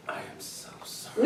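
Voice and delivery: hushed whisper, barely voiced; whispered apology. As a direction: whisper the sorry next to the pastor.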